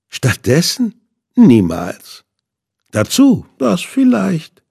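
villain's voice. A narrator's reading. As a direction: savour every word like a sadist. Villain mocks the offer.

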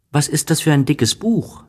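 put-on high, curious child voice; a small boy asks eagerly; little prince. A narrator's reading. Little prince asks the old man about his book, high and curious. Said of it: "sagte der kleine Prinz".

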